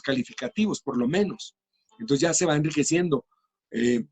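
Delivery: ordinary talk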